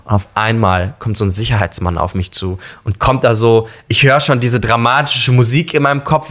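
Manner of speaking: ordinary talk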